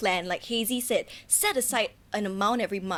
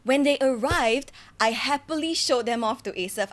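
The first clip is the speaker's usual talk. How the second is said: usual speaking voice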